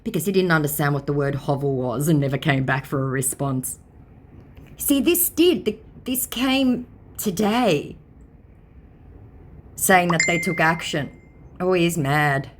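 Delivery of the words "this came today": ordinary talk, nothing marked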